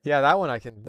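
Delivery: plain reading voice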